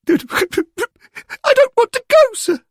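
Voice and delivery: a lad owning up to his fear; scared schoolboy. A narrator's reading. Derek telling the angel he is scared, high and frightened.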